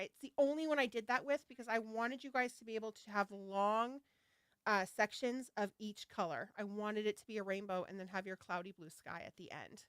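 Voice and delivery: ordinary talk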